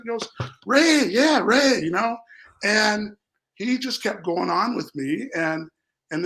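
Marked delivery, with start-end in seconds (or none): 0.7-1.8 loud, high mock recognition; eager playing along. Stranger playing along with a mistaken acquaintance, over-eager. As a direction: exclaim it like you suddenly know the name.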